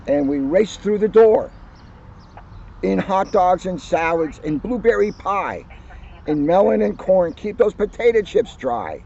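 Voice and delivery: put-on cheerful children's book narration; story reading. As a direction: read it like story time for kids. Reads picture book aloud to audience.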